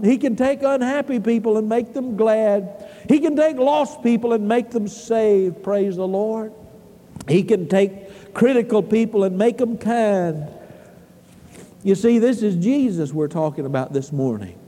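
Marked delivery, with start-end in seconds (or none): none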